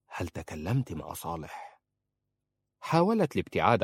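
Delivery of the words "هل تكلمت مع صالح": low, soft, sinister hiss of a question; snakelike voice. Acted. Husband holds her close and probes, low snakelike menace. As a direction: coil around her like a snake, menacing and quiet. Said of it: "في صوت ثعباني".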